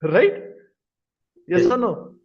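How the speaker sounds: laughing loud check with class. Real, not acted. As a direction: laugh through the question. Laughs while asking class.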